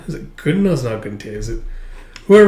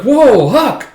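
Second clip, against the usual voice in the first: much higher and louder, caught off guard; startled yelp; 11 semitones higher, 7 dB louder than usual. Retold or imitated. Soldiers startled when Huck catches them, loud high yelp. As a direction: jump at being caught.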